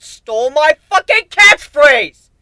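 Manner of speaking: loud, high, slow indignant yell; outraged. Acted. Griffin griping that the changeling stole his catchphrase, loud outrage. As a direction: blow up over the stolen line.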